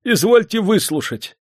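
high, loud pleading; panicked high entreaty; desperate cry. A narrator's reading. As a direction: beg urgently to be heard. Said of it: "возопил он".

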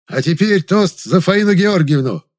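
high, ringing call for a toast; rousing toast. A narrator's reading. Guest proposes a toast to the actress, loud and rousing. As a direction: announce it loudly to the party.

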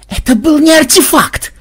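high, loud indignant shout; exploding in outrage. A narrator's reading. Ксенобайт explodes at the word artefact, outraged shout. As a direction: burst out furiously. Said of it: "взорвался Ксенобайт".

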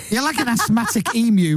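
ordinary talk, nothing marked

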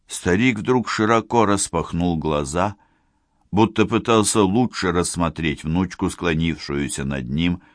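ordinary delivery

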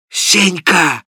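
a loud bellow over the noise; Zimovejkin's yell. A narrator's reading. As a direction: shout the name to cut through the din. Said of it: "завопил в выступлении Зимовейкин".

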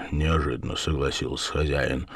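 plain reading voice

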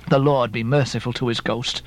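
ordinary delivery, nothing marked

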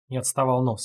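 plain reading voice

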